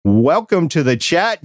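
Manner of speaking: ordinary talk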